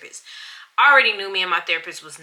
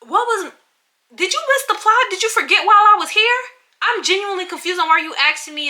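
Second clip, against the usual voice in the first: much higher and louder, incredulous; high, loud, exasperated rant; exasperated outburst; 12 semitones higher, 7 dB louder than usual. Real, not acted. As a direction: vent in loud disbelief. Venting about therapist's question.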